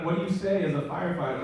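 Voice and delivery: ordinary talk, nothing marked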